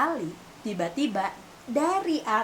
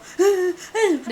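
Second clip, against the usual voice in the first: gasp; 8 semitones higher, 6 dB louder than usual. Retold or imitated. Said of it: "Dan aku nangis yang kayak".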